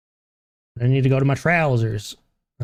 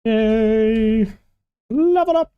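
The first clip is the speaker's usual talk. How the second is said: held, much higher mock-cheer; high-pitched cheer; 9 semitones higher, 3 dB louder, much slower than usual. Player celebrating a level up, high sing-out cheer. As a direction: squeal it out on one high note.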